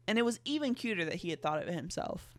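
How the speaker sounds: ordinary talk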